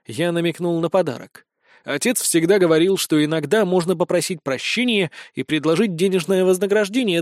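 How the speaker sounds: usual speaking voice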